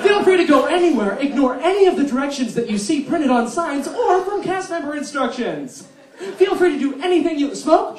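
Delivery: loud, high, laughing mock welcome; mock Disneyland host. Comedian playing a reckless Disneyland greeter, loud and laughing. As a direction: ham up an overeager park greeter.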